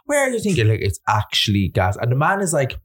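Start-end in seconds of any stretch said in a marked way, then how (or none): 0.1-0.7 put-on high, ranting old man; furious old man. Old man scolding a mattress dumper, high furious rant. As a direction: rant like an outraged old culchie at a litterer. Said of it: "He goes".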